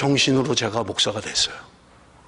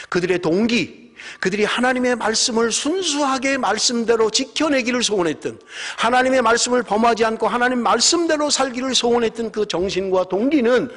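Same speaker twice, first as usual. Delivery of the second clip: impassioned preaching; 8 semitones higher than usual. Acted. Preaching at full force.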